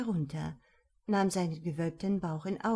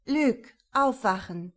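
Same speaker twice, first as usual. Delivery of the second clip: waking call; 3 semitones higher, 6 dB louder than usual. Acted. Wife wakes husband.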